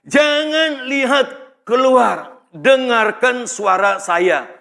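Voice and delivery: slow, drawn-out commanding words; slow, emphatic radio guidance; acted firm instruction. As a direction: act out a controller guiding someone, word by word. Controller instructs panicking passenger by radio.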